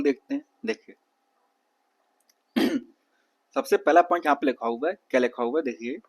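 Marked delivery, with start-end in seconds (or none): none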